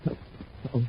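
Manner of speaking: breathed under the breath, barely voiced; a soft alarmed uh-oh; quiet worried whisper; hushed uh-oh. Spotting trouble, hushed.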